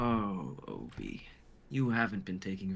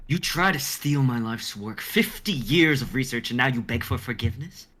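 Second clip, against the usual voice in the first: angry outburst; 4 semitones higher, 9 dB louder than usual. Acted. Doc furious at thief begging.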